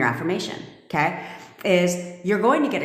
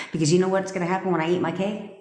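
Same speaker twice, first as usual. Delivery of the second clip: ordinary delivery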